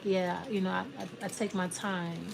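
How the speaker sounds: soft, unhurried answer. Acted. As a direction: answer the boast quietly, in no rush. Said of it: "You're, you're slow".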